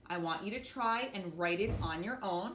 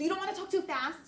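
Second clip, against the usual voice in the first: high, loud and fast, mock rushed talk; rushed demo; 10 semitones higher, 4 dB louder, faster than usual. Shown as a demonstration. Teacher showing students how not to read, rushing. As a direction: rattle it off too fast on purpose. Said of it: "sound the way that you speak".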